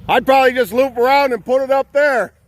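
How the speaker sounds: loud, high call across the woods. Real, not acted. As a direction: shout it out to someone far off. Calling out to helper.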